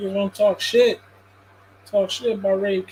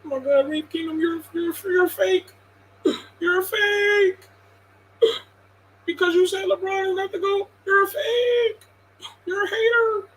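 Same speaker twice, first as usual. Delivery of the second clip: mock crying fan; 8 semitones higher than usual. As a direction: whine like a heartbroken LeBron fan calling him a hater.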